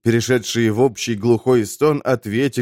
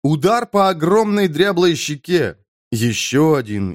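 ordinary delivery

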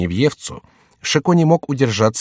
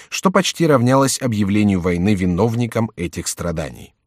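plain reading voice

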